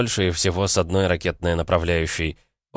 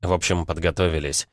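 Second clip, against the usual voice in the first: ordinary delivery, nothing marked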